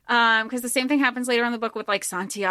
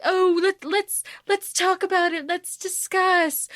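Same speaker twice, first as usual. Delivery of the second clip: mock pleading; 8 semitones higher than usual. Mocking bad guys who lost the upper hand, high mock plea. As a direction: climb into a high, put-on plea. Mocks bullies suddenly wanting to talk.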